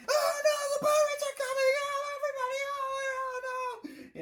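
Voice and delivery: very high, loud mock panic, slowing at the end; panicked Usopp alarm. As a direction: shriek the warning like a frantic liar. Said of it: "page is".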